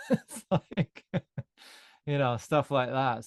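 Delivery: high and amused, laughing through it; light laugh while talking. Real, not acted. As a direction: chuckle at the miracle story. Laughs about the back story.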